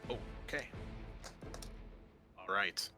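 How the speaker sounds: ordinary delivery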